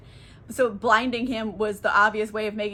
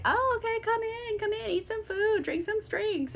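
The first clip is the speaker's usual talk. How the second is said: much higher, sugary inviting voice; sweet witch hostess; 11 semitones higher than usual. Retold or imitated. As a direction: lure them in, overly sweet. Circe luring the men in.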